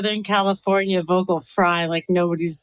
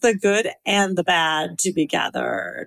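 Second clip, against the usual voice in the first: put-on Valley girl accent ending like a question; Valley girl uptalk; slower than usual. Shown as a demonstration. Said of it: "do everything with a question at the end".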